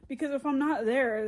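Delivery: usual speaking voice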